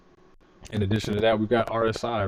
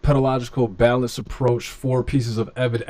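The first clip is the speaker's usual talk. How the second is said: mock chat reader voice; 4 semitones higher, 4 dB louder, slower than usual. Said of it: "Woke says".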